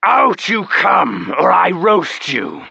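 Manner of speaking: loud, slow, menacing growl of a threat; slow booming dragon threat; threatening dragon. Acted. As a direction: promise revenge like a wounded beast.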